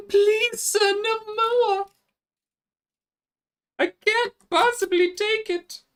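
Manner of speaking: very high, slow British plea from a wheel nut; squeaky British lug nut. Pretend. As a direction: beg in a tiny high-pitched British voice. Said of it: "weirdly British".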